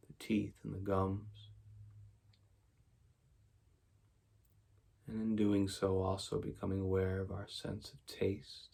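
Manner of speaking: soft, even meditation voice with long pauses; quiet, unhurried mindfulness guidance; guided meditation. Real, not acted. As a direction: guide them gently into stillness, leaving space after each phrase.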